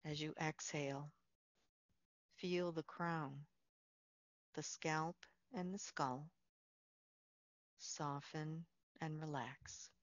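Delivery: soft, unhurried meditation voice with long pauses; guided meditation. Real, not acted. Leads a Yoga Nidra relaxation.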